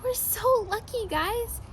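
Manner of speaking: ordinary delivery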